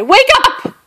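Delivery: very high, loud panicked shout; frightened shout. A narrator's reading. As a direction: shout in fear to wake him. Frightened Bear calling out.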